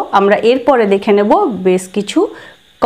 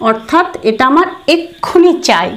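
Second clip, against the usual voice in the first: normal talking voice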